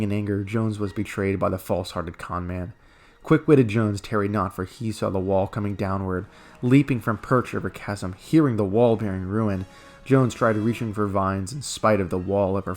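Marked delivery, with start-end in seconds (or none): none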